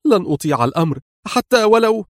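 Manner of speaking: high-pitched, defiant objection; heated refusal, breaking off unfinished; angry protest. A narrator's reading. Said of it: "أن تعترض في غضب".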